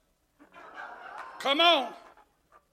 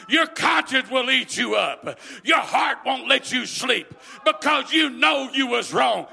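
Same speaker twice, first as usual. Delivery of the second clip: loud preacher's call, pressing hard; rousing sermon cry; full-force preaching; 5 dB louder than usual. Acted. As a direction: drive it home to the church. Preacher rises again.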